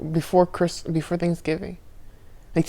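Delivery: normal talking voice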